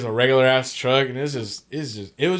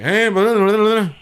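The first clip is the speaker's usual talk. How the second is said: high, louder mock Sinaloa rancho mumble; mock thick rancho accent; 9 semitones higher, 7 dB louder than usual. Mocking the local man's thick Sinaloa rancho accent. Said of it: "the Rancho Sinaloense fucking, uh, accent".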